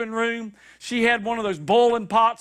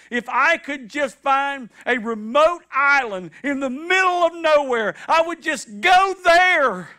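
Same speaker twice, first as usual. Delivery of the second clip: rising plea; 5 semitones higher, 4 dB louder than usual. Retold or imitated. Preacher voices David wanting escape.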